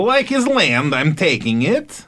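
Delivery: high mocking villain voice; Putin impression. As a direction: play a smug land-grabbing tyrant. Mocking Putin's land grab.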